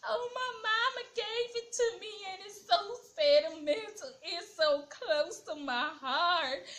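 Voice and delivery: high, mock tearful whine; mocking Portia crying. Vlogger mimicking Portia crying over her doormat, high and whiny. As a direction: ham up a teary sentimental plea. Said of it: "And then she's like".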